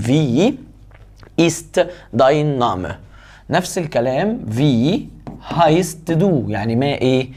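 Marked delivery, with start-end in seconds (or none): 0.1-2.9 slow dictation. Shown as a demonstration. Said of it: "slow model of the question".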